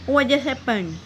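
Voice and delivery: "what just" said as "wha jus", both t sounds dropped; silent t in what and just. Shown as a demonstration. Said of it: "इस तरह से बोलना है".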